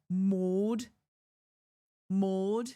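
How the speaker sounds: Maud said as mode, rounded English vowel. Shown as a demonstration. Imitating how English people say the dog's name, English accent. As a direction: round your mouth fully for an English Maud. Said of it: "the way that English people pronounce it".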